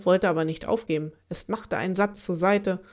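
usual speaking voice

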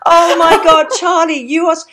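high, loud and gushing. Real, not acted. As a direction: burst out with delight at meeting her. Gushing over the guest.

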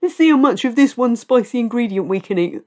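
a light send-up of lads egging each other on; mocking male friends daring each other; mock bloke. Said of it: "it's blokes".

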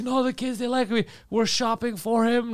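high, slowing mock drunk voice; lightly voiced insistent drunk stranger; imitating drunk woman. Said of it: "And like".

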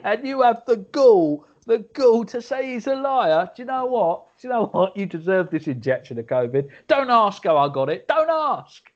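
high, ranting telling-off; irate mum. Acted. Mum punishing her son over Chris Whitty, high-pitched angry rant. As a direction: scold him hotly, pitch jumping up. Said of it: "She's injected covid into his arm and going".

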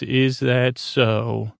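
IS THAT SO stretched out slowly; imitating a slow, deliberate challenge; slow, drawn-out retort. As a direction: draw out each word of the question. Quote redone slowly in character.

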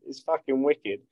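ordinary talk, nothing marked

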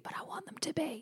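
a soft whispered confession; whispered aside. Real, not acted. Mum admitting she wants musician kids, whispered aside. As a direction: whisper it like a secret. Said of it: "whispered confession".

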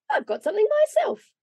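very high, gleeful announcement; excited boast. A narrator's reading. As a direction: crow about your own surprise. Boy Santa crows over his gift.

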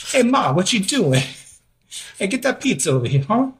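put-on high hammy Italian Brooklyn accent, laughing. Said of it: "like, Italian Brooklyn accent".

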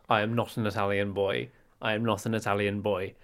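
understated British denial; very British accent. Acted. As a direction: say it politely in a restrained British accent. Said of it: "in your very British accent".

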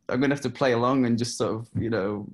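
ordinary talk, nothing marked

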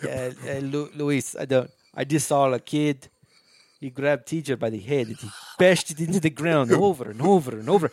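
put-on generic foreign accent, vaguely South African; loose, drifting South African-ish foreign accent; wandering South African accent. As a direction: play a cartel guy in a muddled South African accent. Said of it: "I don't know why this guy's South African now".

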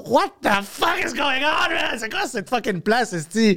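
high-pitched mock yell at life, held back in volume; strained, baffled outcry; bewildered exclamation. Acts out daily bafflement at life.

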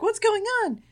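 high-pitched mock alarm; mock-puzzled squeal; imitated bewildered cry. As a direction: voice a companion's question way up high. Acts Joe's alarmed question.

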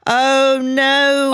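long, hammed-up "oh no"; drawn-out dismay. Townswoman hears the cat was buried, hammed-up drawn-out dismay. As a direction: stretch it out in comic horror.

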